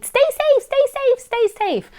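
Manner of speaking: put-on high-pitched, loud toddler chant; squeaky pestering toddler on repeat. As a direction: nag insistently like a little kid.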